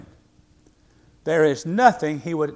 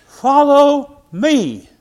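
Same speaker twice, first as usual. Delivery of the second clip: loud, high, very slow call of Jesus; 11 semitones higher, 10 dB louder, much slower than usual. Acted. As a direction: stretch it out like a summons from the Lord. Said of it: "he tells the church members".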